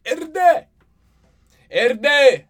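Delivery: loud, high call-out; shouted name. Real, not acted. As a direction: yell his name to him. Calling out his buddy's name.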